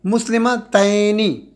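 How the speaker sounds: مسلمتین stretched out very slowly; slow demo. Shown as a demonstration. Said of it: "زبر". (کھینچ کر آہستہ تلفظ)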